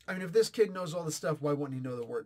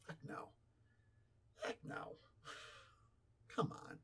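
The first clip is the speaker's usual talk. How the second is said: hushed scoff; 17 dB quieter than usual. Real, not acted. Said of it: "dismissive aside".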